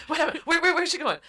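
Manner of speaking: hammed-up panic; frantic questions. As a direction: fire off questions in alarm.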